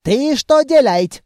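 indignant. A narrator's reading. Officer sees the barrow being tipped, indignant. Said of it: "возмутился эльф-офицер".

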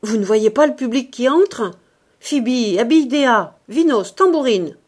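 loud barked orders; vociferating. A narrator's reading. Ursus ordering the maids to work, vociferating. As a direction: bellow commands at the troupe. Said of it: "Ursus vociféra".

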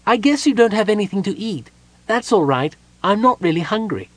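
high, bright-eyed kender chirp; perky kender greeting at the door. A narrator's reading. Kender greets Palin at the door, high and perky.

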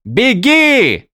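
loud high yell. Acted. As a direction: yell at her to run. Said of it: "заорал Виндель".

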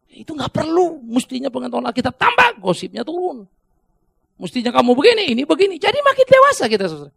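fired-up preacher driving the point home; impassioned preaching. Real, not acted. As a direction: press the congregation harder, climbing in pitch and volume. Preaching at full force.

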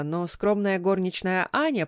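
ordinary delivery, nothing marked

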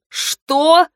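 high, disbelieving question; shocked question. A narrator's reading. Listener hearing the scheme was broken, shocked. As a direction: shoot the pitch up in disbelief.

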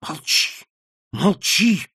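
from hushed hiss to loud frightened cry; threat turning to fear. A narrator's reading. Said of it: "угроза начинала уступать место страху".

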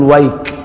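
ويك cut off sharply on the k; stopping on kaf. Shown as a demonstration. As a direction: stop ويك dead on the kaf. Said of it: "يقول".

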